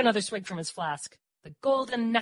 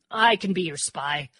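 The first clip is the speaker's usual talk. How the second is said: slow, louder, firm offer; throat cleared, declaring; 6 dB louder than usual. A narrator's reading. Dor volunteers to be Murph's spy, declaring. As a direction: announce it deliberately after clearing your throat. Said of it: "I cleared my throat".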